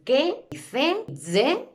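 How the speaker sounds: wrong readings "que", "ce", "ze", each set apart; prononciations erronées. Shown as a demonstration. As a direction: list the wrong sounds "que", "ce", "ze". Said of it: "No se pronuncia ni".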